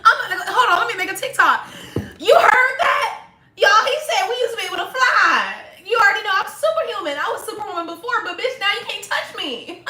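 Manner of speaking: high, loud, laughing, hyped-up rant; high and loud, laughing and shouting with glee; giddy bragging. Retold or imitated. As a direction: gush like you just learned you can fly. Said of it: "I would be like".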